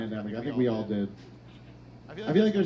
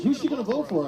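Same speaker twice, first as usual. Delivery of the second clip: nosy bystanders; 10 semitones higher than usual. Retold or imitated. Everybody asking at the polls.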